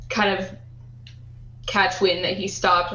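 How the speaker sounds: ordinary delivery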